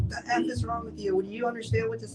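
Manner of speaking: high-pitched mock outrage; retold scolding. Parents demanding answers on waking, mock angry scolding.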